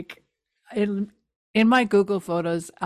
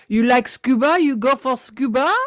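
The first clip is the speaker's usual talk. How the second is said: put-on French accent; 4 semitones higher, 5 dB louder than usual. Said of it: "French scuba guy".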